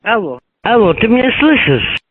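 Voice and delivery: ordinary talk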